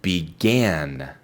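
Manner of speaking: "Began" with a clear an ending; teacher modelling "Began" with a clear an ending; model pronunciation. Shown as a demonstration. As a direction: stress the end of "Began". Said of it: "Ahora el pasado".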